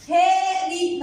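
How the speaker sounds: slow "haaa-di" for هاء دي; long vowel. Shown as a demonstration. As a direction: stretch the first "aa" in هاء دي. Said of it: "مد ألف". (مدّ الألف في هاء دي)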